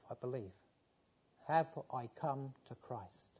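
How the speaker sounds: normal talking voice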